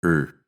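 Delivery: "er" as a short r-colored schwa; weak er; er vowel. Shown as a demonstration. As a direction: neutral "er" sound. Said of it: "the vowel is er".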